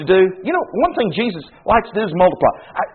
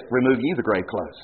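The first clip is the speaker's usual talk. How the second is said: low, soft and hushed, like a quiet decree; solemn Jesus command; 10 semitones lower, 9 dB quieter than usual. Retold or imitated. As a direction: let the command sink low and grave. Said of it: "But he says".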